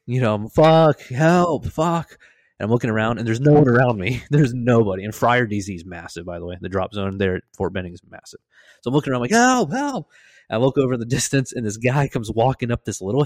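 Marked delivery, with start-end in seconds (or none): 0.5-2.2 loud, high drawn-out cries, re-enacted; retold yell of pain and need; screaming for help. Injured jumper alone on the drop zone, screaming for help. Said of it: "I'm screaming". 9.3-10.1 high, loud drawn-out yell; re-enacted cry across an empty field; calling for help. Injured jumper looking around the drop zone, calling for help.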